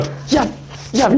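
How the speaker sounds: zap strangers like a tiny wizard; mild playful child's yap; toddler's spell. Retold or imitated. Toddler zapping passers-by.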